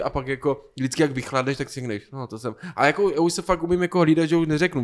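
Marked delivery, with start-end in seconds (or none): 2.1-2.5 quiet self-talk. Retold or imitated. Retold self-talk after cooling down.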